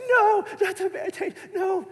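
very high mock wail; hammed-up, squealing little-girl refusal; dramatic toddler. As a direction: imitate a panicking two-year-old's protest. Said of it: "this dramatic little two-year-old".

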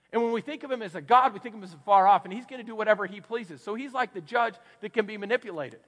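ordinary talk, nothing marked

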